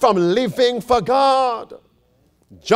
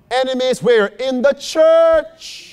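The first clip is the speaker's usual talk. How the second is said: normal talking voice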